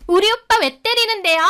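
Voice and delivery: very high, whiny protest; indignant. Acted. Rival defending her lover from a slap, indignant.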